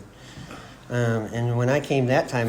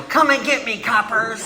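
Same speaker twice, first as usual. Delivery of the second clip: put-on high-pitched shout in an old gangster-movie style; defiant yell; 10 semitones higher, 4 dB louder than usual. Drunk man in the gully taunting police, defiant yell. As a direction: holler it out like a cornered movie outlaw. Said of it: "I yell".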